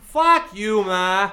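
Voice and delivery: put-on loud, high Boston accent through laughter; laughing Boston accent. Joking about the teen telling his mom off, laughing Boston accent. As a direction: yell it in Boston accent while cracking up. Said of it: "in a Boston accent".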